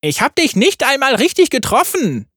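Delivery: high, cheeky yordle voice; playful little gunner; Tristana voice. A narrator's reading.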